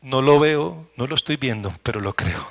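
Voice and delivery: a believer whispering his trust to God; hushed confession of faith. Pretend. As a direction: murmur it like a quiet prayer. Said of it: "Cuando dicen".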